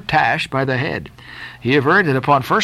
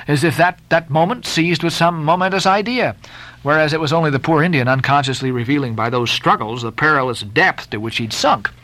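ordinary delivery, nothing marked

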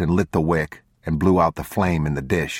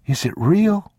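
put-on high, louder child's question; young boy; 3 dB louder than usual. A narrator's reading. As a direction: ask in disbelief like a small boy. Boy voiced by narrator.

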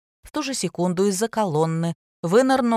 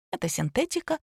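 higher, softer breezy fib; glib lie; 8 semitones higher, 5 dB quieter than usual. A narrator's reading. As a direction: toss off a lie lightly. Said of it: "бойко солгала Терёшкина".

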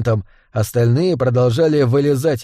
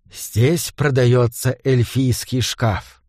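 slow, deliberate password; 2 semitones lower, much slower than usual. Acted. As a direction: spell out the code phrase slowly. Пароль у двери. (медленно, как пароль)

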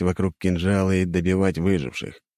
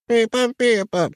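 tiny creature squeaking, much higher and slow; plaintive squeak; 3 dB louder, slower than usual. A narrator's reading. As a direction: whimper it like a hurt little animal. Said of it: "жалобно прозвучало от него".

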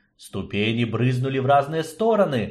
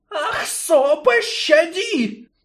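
high, loud crying hiss; pained pleading snake; 4 dB louder, much slower than usual. Acted. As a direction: beg in pain, hissing. Snake begs for mercy.

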